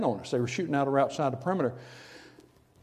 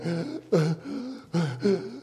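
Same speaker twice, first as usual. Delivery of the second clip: winded gasp; 3 semitones higher than usual. Retold or imitated. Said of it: "trying to catch my breath".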